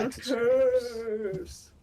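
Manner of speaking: high, drawn-out spooky ghost. Acted. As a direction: wail it like a cartoon ghost. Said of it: "stereotypical ghost voice".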